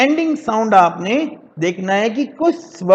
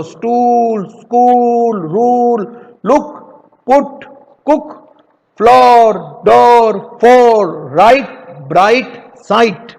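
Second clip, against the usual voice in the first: louder drill, each word separate; loud repeat drill; 5 dB louder, slower than usual. Shown as a demonstration. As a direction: call the word list out loud once more. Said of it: "एक बार और बोलो". (बच्चों के लिए ज़ोर से दोहराना)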